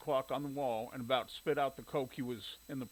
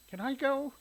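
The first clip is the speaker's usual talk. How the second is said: put-on little girl voice; 11 semitones higher, 5 dB louder than usual. A narrator's reading. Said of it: "she asked".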